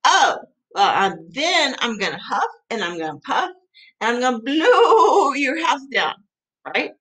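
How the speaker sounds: theatrical threat swelling on "blow"; wolf's threat. Acted. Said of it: "I'm like".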